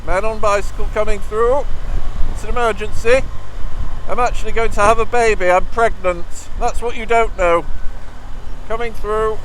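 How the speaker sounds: loud and high, calling out to clear the way; hammed-up pregnant cyclist yelling for room; loud, high joke plea to let him through; mock emergency shout. Pretends to be pregnant, shouting to clear path.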